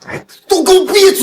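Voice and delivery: very high, exploding yell; exasperated shout. Acted. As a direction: silence them all with a sudden high yell. Silences everyone in the quarrel. (气急败坏地尖声喝止)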